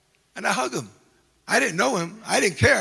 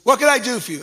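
brusque; 5 semitones higher, 7 dB louder, faster than usual. Retold or imitated. Officer finally addresses him.

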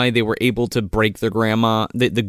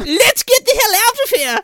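much higher fleeing yell; panicked shout. Retold or imitated. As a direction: scream it like fleeing minions.

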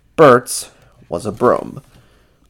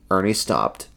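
plain reading voice

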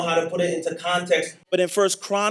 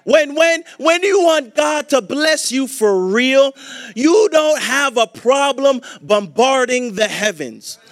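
louder, higher, driving preacher's build; preaching at full force; 3 semitones higher, 5 dB louder than usual. Real, not acted. Pastor rousing the church, building into forceful preaching. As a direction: hammer each phrase home to the congregation.

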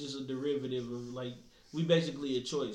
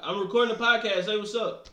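raised to get through a bad line; louder repeat; 5 semitones higher, 6 dB louder than usual. Real, not acted. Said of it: "Say it again".

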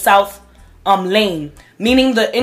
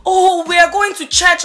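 put-on African accent; 8 semitones higher than usual. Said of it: "it's saying in Tree".

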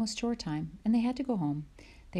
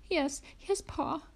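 put-on much higher little girl's sputter; Laura sputtering; 10 semitones higher, slower than usual. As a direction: sputter out the answer after the ducking. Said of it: "Laura sputtered".

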